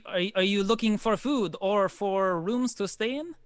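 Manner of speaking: polite innkeeper asking the guests; lightly voiced innkeeper.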